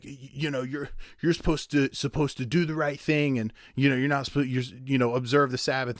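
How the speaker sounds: nagging Pharisee voice. Retold or imitated.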